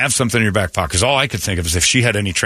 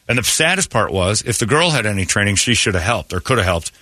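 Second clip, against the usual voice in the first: ordinary delivery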